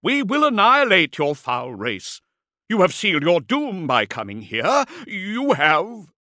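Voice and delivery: loud, high defiant threats; blustering shout. A narrator's reading. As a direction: hurl doom at the enemy, then break off unfinished. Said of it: "he shouted at Bezrak".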